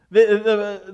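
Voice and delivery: ordinary delivery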